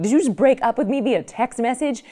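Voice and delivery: high, loud, incredulous cry; can't believe what you just read, shout it high; outraged disbelief. Retold or imitated. Dumped by text, crying out to her boyfriend in high, loud disbelief. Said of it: "And you're like".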